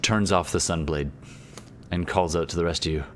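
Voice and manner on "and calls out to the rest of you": usual speaking voice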